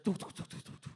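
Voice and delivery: whispered gunshot noises; imitated gunfire; mock gunfire. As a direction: spit out the shots.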